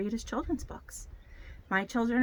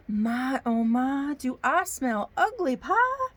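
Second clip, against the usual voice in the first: put-on hopeful storybook bear with a Southern accent; 3 semitones higher, 4 dB louder, much slower than usual. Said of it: "I get a Southern accent".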